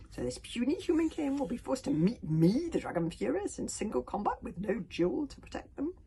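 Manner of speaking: incredulous, excited dragon question; gloating dragon. A narrator's reading. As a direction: relish the coming duel. Dragon Furious gloats in the story.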